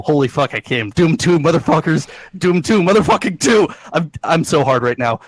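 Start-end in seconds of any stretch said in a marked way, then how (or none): 0.9-3.7 loud, hyped reading of own notes. Retold or imitated. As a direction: bellow the notes like a fan. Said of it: "So it says".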